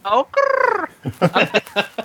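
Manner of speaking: high "Oh, grrr" with a long rolled r; playful trill. Pretend. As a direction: roll the r of "grrr" like a purring motor. Riffing on neural with a trill.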